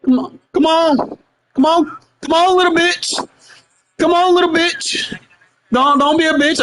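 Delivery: high, mocking taunts at the opponent; taunting. Real, not acted. Trash-talking the opponent across the match, high mocking taunt. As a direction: goad him like a schoolyard bully, pitched high.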